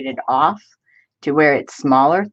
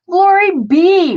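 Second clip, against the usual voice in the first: excited greeting; 14 semitones higher, 7 dB louder, slower than usual. Real, not acted. Greeting a viewer.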